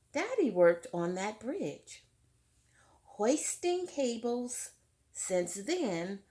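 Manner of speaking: clear, fuller read-aloud voice to the class; story narration. A narrator's reading. Teacher reading Tar Beach to her class, lively read-aloud. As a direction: read it out like a picture book for children. Reads book text aloud.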